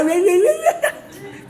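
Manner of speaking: loud, high wordless scolding noise; mock angry yammering. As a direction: imitate a ranting parent in high, loud gibberish. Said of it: "Bapak paling marah". (ocehan marah tiruan bernada tinggi)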